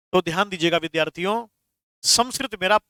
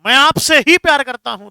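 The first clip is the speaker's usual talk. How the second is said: louder and higher, ही hit firmly; stress on ही; 5 semitones higher, 7 dB louder than usual. Shown as a demonstration. Teacher acting Bunty's declaration to Mona, stressing ही. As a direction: give ही the weight, mean only her. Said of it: "अब बंटी ने कहा".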